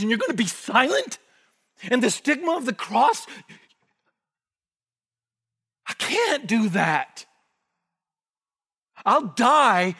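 proud zealot Peter. Retold or imitated. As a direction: brag like a hothead who wants glory. Voicing Peter's zealot pride.

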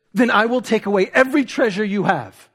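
acted escalating anger. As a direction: threaten as the enraged empress. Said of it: "she becomes more angry".

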